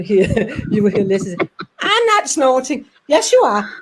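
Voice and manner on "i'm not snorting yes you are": 1 mock bickering. Acted argument between two voices.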